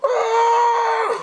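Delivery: long, high, loud imitated scream; mock scream. Imitating the pilot getting blown up, high screaming. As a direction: scream like a doomed movie pilot. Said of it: "you just see him go like".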